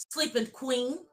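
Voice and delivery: ordinary delivery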